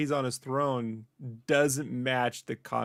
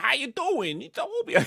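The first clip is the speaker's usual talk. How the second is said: very high, odd alien voice; 12 semitones higher, 3 dB louder than usual. Retold or imitated. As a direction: squeak it out like a cartoon alien. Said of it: "if I can do the voice".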